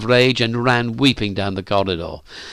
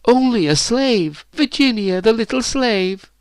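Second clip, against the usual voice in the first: mocking taunt; 12 semitones higher than usual. A narrator's reading. Phyllis keeps mocking Virginia, jeering. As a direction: taunt her in a high pitch. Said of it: "laughed Phyllis".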